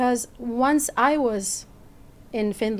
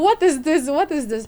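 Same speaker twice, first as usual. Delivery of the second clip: mock alarm; 5 semitones higher, 4 dB louder, much faster than usual.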